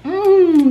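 high, loud mmm of pleasure; a big appreciative hum; savoring mmm. Real, not acted. Vlogger smelling the peach prosecco candle, loud pleased mmm.

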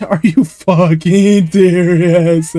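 slow, drawn-out incredulous cursing; slow, strained disbelief; angry disbelief. Real, not acted. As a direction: stretch it out in exasperation. Disbelief after being hit in game.

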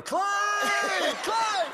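high yelled name; running and shouting for Clay to start the Jeep; calling out. Retold or imitated. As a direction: yell his name across the road. Yelling for friend while running.

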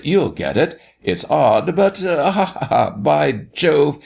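high, amused exclaiming with ha-ha-ha; laughing roar. A narrator's reading. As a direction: act the doctor talking through his laughter. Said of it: "The doctor laughed, laughed aloud".

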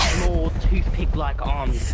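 loud, slow wildlife-show narration; hammed-up animal commentary; mock nature narrator. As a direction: draw it out like a documentary host.

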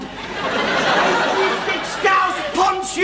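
a slow, high, half-laughing shout of an insult; mock insult shout. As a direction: yell the insult while laughing. Trading insults in a family row.

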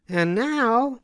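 high hen voice, determined; doing it herself; Little Red Hen. A narrator's reading. Hen deciding to make dough alone, high voice. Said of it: "said the Little Red Hen".

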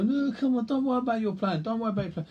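high, wheedling mock tempter; put-on high pleading to give in; coaxing friend. Imitating friends tempting one off diet.

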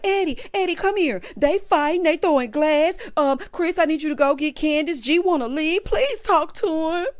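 high, urgent mock child voice; tattling kid. Housewife running to husbands about a fight, tattling kid voice. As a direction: whine it like a kid telling on siblings. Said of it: "like she's a kid telling on her younger siblings".

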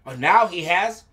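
plain reading voice

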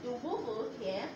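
usual speaking voice

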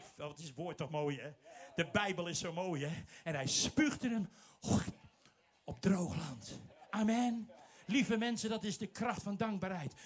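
normal talking voice

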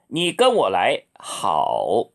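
ordinary talk

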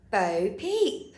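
plain reading voice

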